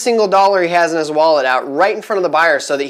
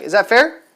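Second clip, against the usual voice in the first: "Is that fair?" with the voice climbing up on "fair"; rising tune on fair; 4 semitones higher than usual. Shown as a demonstration. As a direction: lift "fair" into a hopeful question. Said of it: "my voice kinda goes up like".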